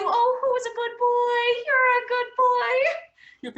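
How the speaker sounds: put-on high, slow doggy baby talk; cooing praise for a good boy. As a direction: coo at the dog like a pet owner. Praising the dog.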